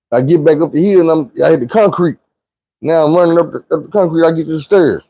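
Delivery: normal talking voice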